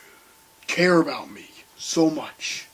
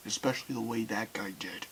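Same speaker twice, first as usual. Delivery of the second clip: whisper; 4 semitones lower, 13 dB quieter than usual. Real, not acted. Unvoiced trailing remark.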